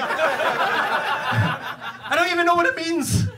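normal talking voice

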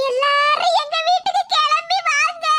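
slow, tearful plea; drawn-out tearful "வீட்டுக்கு". Acted. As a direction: stretch each word like a wail. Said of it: "சும்மா இழுத்துக்கிட்டே இருக்காதே".

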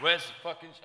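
high, loud put-on voice; imitated indignant demand. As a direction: mimic an agitated performer demanding answers. Acting rapper demanding his hype man.

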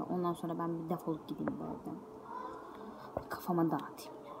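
unvoiced fed-up whisper. Real, not acted. Telling viewers she needs to clear her head, a tired whisper. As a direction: breathe it out, worn out.

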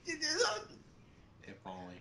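slow, hushed and high; breathy hush. Pretend. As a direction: drop to a soft hush. Whispered joke aside.

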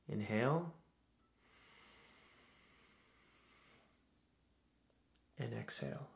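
soft, unhurried meditation voice with long pauses; guided meditation. Acted. Host leading listeners through breathing to calm anxieties, guided meditation. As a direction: guide them gently through each breath, leaving space.

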